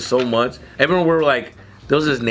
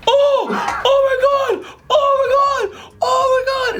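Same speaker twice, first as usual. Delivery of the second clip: laughing oh my god. Retold or imitated. Retelling a kid's shocked squeal. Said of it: "he starts going".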